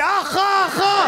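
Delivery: high shouted mock "ah ah ah" warning; hammed-up lookout cry; mock alarm cry. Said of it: "Elle a pas crié".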